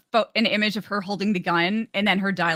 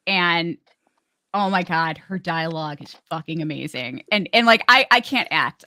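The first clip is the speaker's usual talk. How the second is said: normal talking voice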